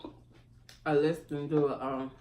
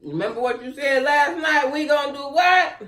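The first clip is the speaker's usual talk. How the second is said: loud, higher hype-up; hyped up; 9 semitones higher, 10 dB louder than usual. Real, not acted. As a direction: pump him up. Hyping husband up.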